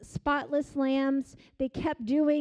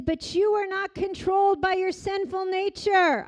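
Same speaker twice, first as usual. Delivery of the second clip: preaching voice; 6 semitones higher, 5 dB louder than usual. Acted. Preacher reads scripture forcefully.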